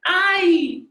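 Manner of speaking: high, loud yelp of "Ay!" on falling out; Colombian student's cry. Retold or imitated. Imitating a student who falls out of a handstand, loud high yelp. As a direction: squeal "Ay!" sharp and high. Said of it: "she'd go".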